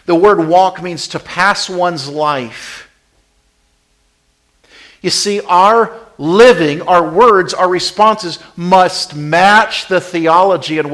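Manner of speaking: loud, high, emphatic pulpit delivery; full-force preaching. Real, not acted. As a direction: drive each point home to the congregation.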